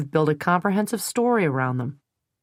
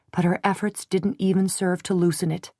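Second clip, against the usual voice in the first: plain reading voice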